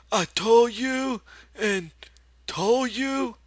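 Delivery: very high, loud, slow scolding; a stern old aunt wagging her finger; scolding aunt in Lenny's voice. A narrator's reading. Aunt Clara scolds Lenny, very high and loud in his voice. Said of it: "when she spoke, it was in Lenny's voice".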